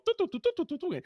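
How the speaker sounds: high sung droid beeps; droid beeping. Retold or imitated. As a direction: sing it like a chirpy robot. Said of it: "And they go".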